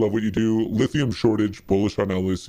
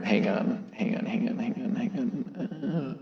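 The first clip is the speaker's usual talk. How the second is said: normal talking voice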